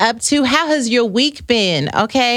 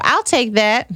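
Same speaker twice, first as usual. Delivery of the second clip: normal talking voice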